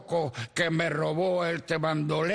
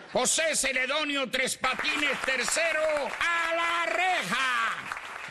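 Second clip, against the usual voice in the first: calling out; 9 semitones higher than usual. Acted. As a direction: summon him to the bench at full voice. Bailiff calls the defendant forward.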